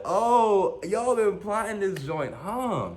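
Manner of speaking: high, slow, drawn-out realisation; mock astonished whine. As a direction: draw it out high without shouting. Repeats comic realisation more softly.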